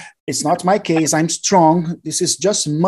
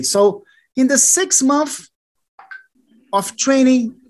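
usual speaking voice

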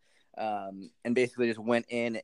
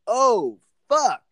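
high, loud mock-shocked outburst, pitch up and louder; 12 semitones higher, 10 dB louder than usual. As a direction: play it like the claim just floored you. Retold shocked reaction to salesman.

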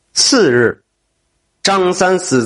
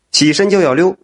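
ordinary delivery, nothing marked

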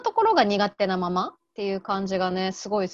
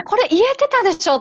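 surprised protest; 9 semitones higher, 6 dB louder, faster than usual. Retold or imitated. Quoting her own surprised reaction.

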